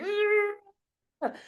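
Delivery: high, exasperated ugh; annoyed groan. Retold or imitated. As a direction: groan like traffic just cut you off.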